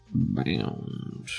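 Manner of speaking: ordinary delivery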